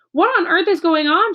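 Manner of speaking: startled high cry of alarm; shocked child demanding to know. Acted. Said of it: "she cried".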